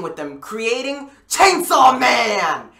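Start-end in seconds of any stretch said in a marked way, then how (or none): 1.3-2.7 drawn-out shouted announcement; big stretched-out name reveal; hyped title shout. Real, not acted.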